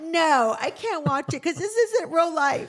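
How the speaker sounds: half-laughing, insisting it isn't real; laughing protest. Retold or imitated. Telling her husband the show isn't real life, laughing and exasperated.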